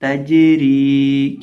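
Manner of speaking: तजरी with the री held one alif long; lengthened vowel. Shown as a demonstration. Teacher shows the long vowel in तजरी. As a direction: stretch the री in तजरी. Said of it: "एक अलिफ के बराबर इसको लंबा करेंगे".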